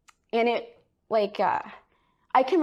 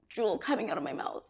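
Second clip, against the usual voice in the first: ordinary talk, nothing marked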